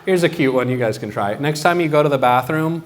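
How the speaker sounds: ordinary talk, nothing marked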